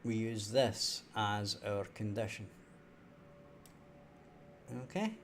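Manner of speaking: ordinary talk, nothing marked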